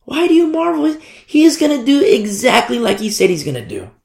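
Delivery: high, insistent angel voice; angel's voice. Retold or imitated. Angels tell disciples he will return, high angel voice. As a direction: pitch it up like an exasperated angel. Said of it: "the angels are like".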